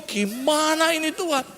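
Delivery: high-pitched helpless appeal to God; acted confusion in prayer; bewildered plea. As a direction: plead upward, at a loss. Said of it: "Saya bingung".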